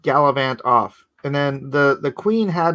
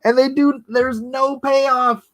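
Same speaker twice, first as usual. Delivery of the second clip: high-pitched incredulous complaint; amused exasperation; 11 semitones higher, slower than usual. Real, not acted. Complaining about the film's missing payoff, incredulous. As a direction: protest it high, stretching the last words.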